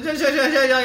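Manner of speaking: loud mock yell of an angry driver; imitating shouting taxi driver. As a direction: bark it like a furious cabbie. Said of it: "eles gritavam muito alto". (imitando taxista gritando)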